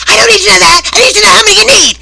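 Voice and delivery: high furious yell at full volume; boss screaming. Retold or imitated. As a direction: scream it like a boss who's lost it. Boss yelling in retold scene.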